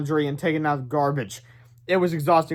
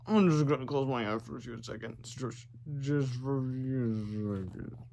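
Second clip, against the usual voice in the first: lower, softer, slowing into sleep; drifting off; 4 semitones lower, 10 dB quieter than usual. A narrator's reading. George in the beanbag, fading into sleep. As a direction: fade away as you fall asleep.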